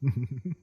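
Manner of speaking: rippling little laugh trailing off; amused chuckle. Acted. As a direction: chuckle knowingly at your clients. Amused at dragons' shopping habits.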